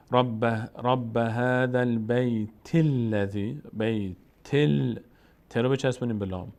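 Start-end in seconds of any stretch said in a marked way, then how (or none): 0.1-5.0 slow chant running البیت into الذی as "baytil-ladhi"; joined recitation. Shown as a demonstration. Teacher shows joining two verses, linked slow recitation. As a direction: link بیت to the next l with an i, slowly. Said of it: "که اینطور بگیم".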